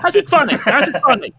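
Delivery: high, loud, comic disbelief; hammed-up baffled protest; exasperated outburst. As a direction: demand it in mock outrage. Acting out exasperation at the show.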